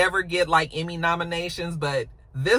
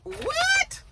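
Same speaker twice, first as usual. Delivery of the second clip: shocked what. Retold or imitated. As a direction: caught totally off guard. [name] shocked about rehab intake.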